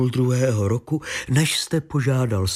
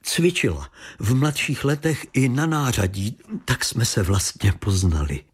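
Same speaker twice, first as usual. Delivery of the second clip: usual speaking voice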